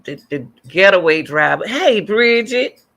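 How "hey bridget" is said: high, loud, drawn-out hello; delighted-to-spot-you call, pitched up; calling out a greeting. Real, not acted. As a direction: call out to a friend, stretching the words. Greets viewer joining the stream.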